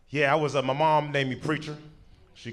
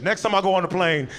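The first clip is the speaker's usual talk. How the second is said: usual speaking voice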